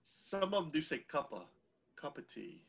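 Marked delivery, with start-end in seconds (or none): 2.0-2.6 soft British accent. Shown as a demonstration. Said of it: "try a little softer".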